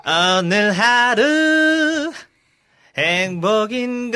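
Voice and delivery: high, loud, slow rock-singer voice; [name] impression. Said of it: "저 그럼 [name] 선배님".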